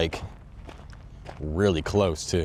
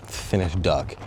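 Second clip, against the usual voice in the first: ordinary talk, nothing marked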